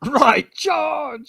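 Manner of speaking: battle cry. Retold or imitated. Quotes knights' battle order.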